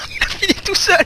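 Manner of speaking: squeaky laugh-cracked high voice. Real, not acted. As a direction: speak through a laugh. Laughing at a teammate.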